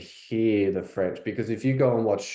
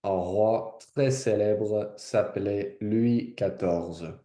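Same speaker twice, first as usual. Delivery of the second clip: slow, careful French accent; French repeat-after-audio; slower than usual. Shown as a demonstration. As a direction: follow the native French model slowly, word by word. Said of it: "that's how you can do it".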